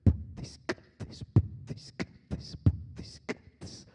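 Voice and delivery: "Put this" voiceless, only percussive consonants; rhythmic whispered "Put this" drum pattern; beatboxing. Shown as a demonstration. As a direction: loop "Put this" as a drum beat with no voice. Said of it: "forget saying the words altogether".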